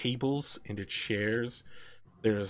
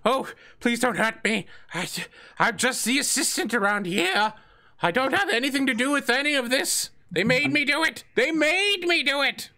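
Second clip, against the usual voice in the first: put-on high pleading old man voice; begging old assistant; 10 semitones higher than usual. Old assistant begs the dwarf not to hurt him. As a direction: beg for mercy, voice way up. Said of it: "He's like".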